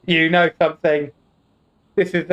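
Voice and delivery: ordinary talk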